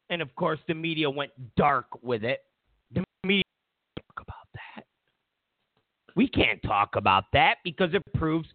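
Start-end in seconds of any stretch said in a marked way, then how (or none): 4.0-4.8 mock hushed whisper; whispered aside. Whispering the media's hush-hush line. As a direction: whisper it like a secret.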